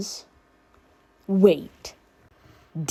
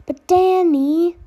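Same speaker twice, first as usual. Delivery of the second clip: high, slow, louder drawn-out whine; whining; 10 semitones higher, 6 dB louder, much slower than usual. A narrator's reading. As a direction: stretch his name out like a pleading kid. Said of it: "the girl whined".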